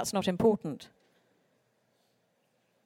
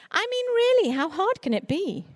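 much higher, sing-song scornful echo; mock the careless boast with a pitch leap; 9 semitones higher, 3 dB louder than usual. Speaker mocking those who belittle children's books, in a high sneer.

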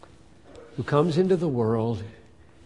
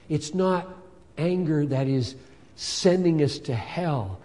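ordinary talk, nothing marked

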